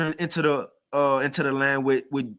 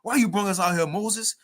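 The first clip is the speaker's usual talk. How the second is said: higher, whining protest; pitched-up grumble of complaint; mock complaining to Moses; 6 semitones higher than usual. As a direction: imitate ungrateful people griping. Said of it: "you still said".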